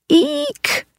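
very high soft squeal; a thrilled little shriek. Acted. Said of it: "cried the kids".

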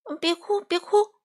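high, anxious hushing of a crying friend; flustered comforting. Acted. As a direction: rush to soothe him, a bit panicked. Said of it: "花袜子慌了".

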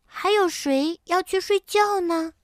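high-pitched, curious cub voice; curious little bear. A narrator's reading. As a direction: ask like a small child full of wonder. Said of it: "好奇地问".

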